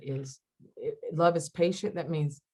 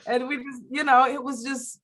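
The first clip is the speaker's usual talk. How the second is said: ordinary delivery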